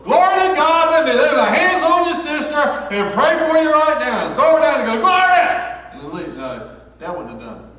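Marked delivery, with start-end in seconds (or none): 0.0-5.5 loud, high hammed-up preacher yell; over-the-top shouted glory prayer; mock holy-roller shout. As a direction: belt it like a revival preacher laying hands. Mock preacher shouting while praying.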